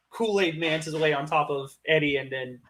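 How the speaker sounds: normal talking voice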